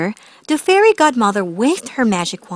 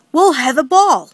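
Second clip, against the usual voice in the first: louder, higher announcement; sudden bright idea; 5 semitones higher, 6 dB louder than usual. A narrator's reading. The king struck by a royal idea, excitedly announcing. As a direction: proclaim the plan with sudden excitement.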